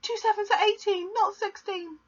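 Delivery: put-on very high, frightened child's voice; scared pupil correcting himself, very high; anxious boy. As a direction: squeak it out desperately. Boy pleading answer.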